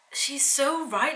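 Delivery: slow agreement. Retold or imitated. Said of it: "And I was like".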